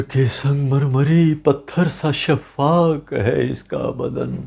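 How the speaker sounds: tormented husband wrestling with his own soul; anguished Othello. Acted. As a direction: recite as a brooding, dramatic stage monologue. Recites Othello's closing monologue as poem.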